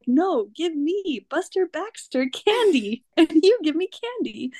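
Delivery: mock-grand kid demand. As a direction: spell out the demand like a grand decree. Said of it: "And he's like".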